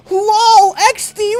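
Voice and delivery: high squeaky mockery; mocking high squeal. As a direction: squeal it like a meme. Mocking internet slang.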